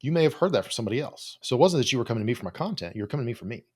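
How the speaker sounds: usual speaking voice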